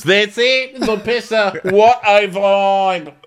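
high, loud and slow put-on voice; hammed-up cheery host; imitating a chipper hostess; mock Katie.

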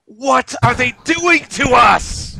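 slow high hammed-up shout; mock panic. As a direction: wail like a victim. Mock panic about critical theory.

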